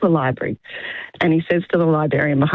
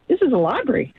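higher-pitched affronted librarian; gently scandalised correction, pitched up; mild indignation; 7 semitones higher than usual. Acted. Librarian setting a confused customer straight, mildly indignant.

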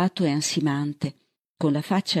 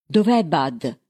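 urgent demand, slightly raised voice; a restrained narrated shout; mild shout; 4 dB louder, slower than usual. A narrator's reading. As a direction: call it out with contained urgency. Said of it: "gridò Bird".

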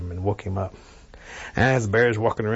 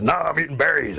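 higher, forceful bear refusal; grumpy bear; 5 semitones higher than usual. Acted. Bear refusing to let thirsty Rabbit by, grumpy bear voice. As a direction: bear annoyed at being interrupted eating.

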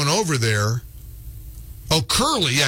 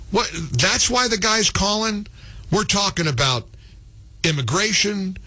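ordinary talk

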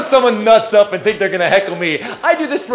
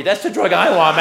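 normal talking voice

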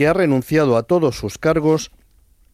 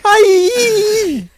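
very high, loud mock coo; baby talk; 5 dB louder than usual. Radio host mimicking adults fawning over a baby, baby talk. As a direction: squeal as if cooing over a baby. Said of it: "cuando le hablas a un bebé que dices".

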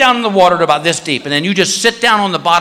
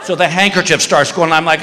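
ordinary talk, nothing marked

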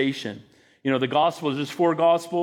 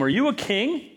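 sarcastic; 6 semitones higher than usual. Acted. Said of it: "there's some level of sarcasm".

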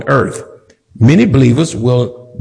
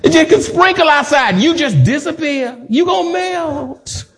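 laughing mockery; 10 semitones higher than usual. Real, not acted. Mocking those who fear rain.

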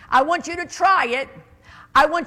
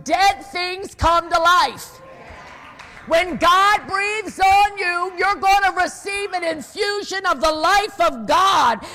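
full-force preaching; 4 semitones higher than usual. Acted. Pastor stirring the congregation toward hope, preaching at full force. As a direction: build it up like a revival preacher.

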